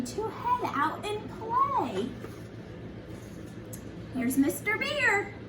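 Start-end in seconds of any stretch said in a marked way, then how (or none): none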